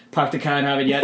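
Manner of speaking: mock Boston accent with dropped R's; broad Boston vowels, no R's. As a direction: do a hammy Boston warm-up phrase. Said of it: "a line in a Boston accent".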